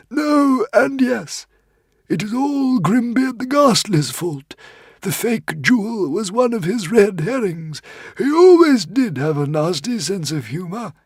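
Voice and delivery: tiny frantic squeaking creature; squeaky little dragon. A narrator's reading. Woden's Fang explains.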